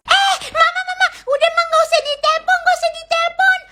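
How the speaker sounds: high, frantic begging; panicked pleading. Acted. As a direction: stop her before she dials. Child panics, stops mom calling.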